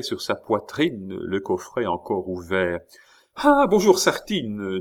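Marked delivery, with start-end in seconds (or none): 3.4-4.8 high bright cheerful hello, much higher. A narrator's reading. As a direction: greet him sunnily with a smile. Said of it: "avec son gai sourire".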